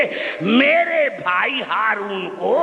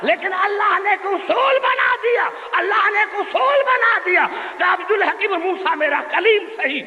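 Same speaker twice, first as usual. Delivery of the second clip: preaching at full force; 7 semitones higher than usual. Real, not acted. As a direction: hammer the point home to the crowd. (پورے جوش سے خطابت)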